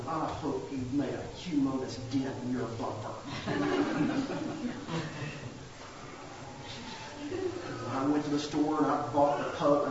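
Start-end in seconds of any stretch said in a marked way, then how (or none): 0.1-3.1 spiteful mutter. Retold or imitated. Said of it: "I stood out there going". 3.1-7.9 soft rising laugh. Real, not acted. Laughing at own spite.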